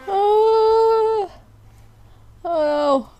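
high, sharp cry of fright; startled cry. Real, not acted. Gamer jolted by a jump scare, real startled cry. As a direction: jump out of your skin. Sudden scare in horror game.

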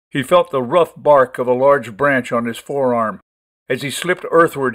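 ordinary delivery

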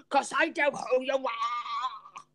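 slow, drawn-out mock Irish accent; Jason. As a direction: stretch it out in a bad Irish impression. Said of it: "I'm doing an impression of you".

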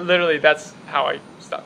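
usual speaking voice

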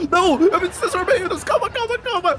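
high panicked yelps while fleeing; run, calling out in a panic; being chased. Real, not acted. Transformed, fleeing the other player.

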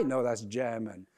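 mildly weary, low correction. Acted. Customer correcting barista on his name, low weary reply. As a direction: drop low to correct them.

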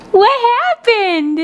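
ordinary delivery

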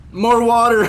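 high, loud cry of disbelief; dismayed yell. Real, not acted.

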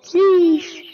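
high sheesh. Acted. Kids trading sheesh calls.

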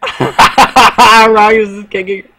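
laughing. Real, not acted. Laughing during game.

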